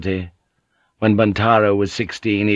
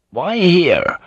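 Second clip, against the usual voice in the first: short, irritated question; 8 semitones higher, 4 dB louder than usual. Acted. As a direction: ask it with clear annoyance. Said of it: "Blackthorn asked irritably".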